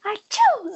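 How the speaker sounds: breathy sneeze noise, no voice; mock child's sneeze; imitated sneeze. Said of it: "he went like".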